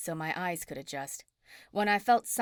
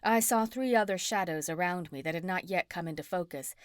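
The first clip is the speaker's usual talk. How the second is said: usual speaking voice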